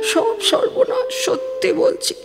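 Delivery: high, breaking voice close to tears; a mother sobbing out her hopelessness; crying. Acted. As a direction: weep through the words in despair. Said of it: "মা, কেঁদো না মা".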